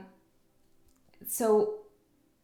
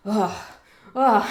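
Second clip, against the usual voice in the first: frustrated groans; 10 dB louder than usual. Retold or imitated. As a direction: blurt out two fed-up groans at a small annoyance. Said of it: "I was just so frustrated".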